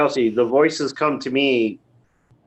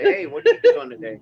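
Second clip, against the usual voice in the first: high, quick pet-talk voice; chirpy voice for a dog; 13 semitones higher, faster than usual. Retold or imitated. Talking to Fido the dog.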